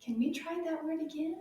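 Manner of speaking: ordinary delivery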